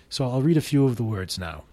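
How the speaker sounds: ordinary talk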